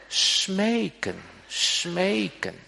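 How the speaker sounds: smeken said high and drawn out; "smeken", "smeken" with rising fervour; pleading emphasis. Shown as a demonstration. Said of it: "eigenlijk nog sterker". (smeken met nadruk, hoog en smekend)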